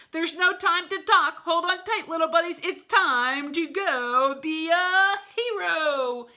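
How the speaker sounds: rousing high battle cry; heroic wolf. Acted. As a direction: pump them up as you throw. Wolf launching them.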